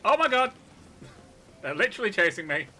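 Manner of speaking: high, loud panicky yell; alarmed yelling. Real, not acted. Player chased by game spiders, alarmed yelling. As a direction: cry out while being chased.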